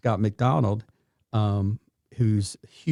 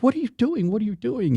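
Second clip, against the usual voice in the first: shocked; 11 semitones higher than usual. Retold or imitated. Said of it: "and she was like".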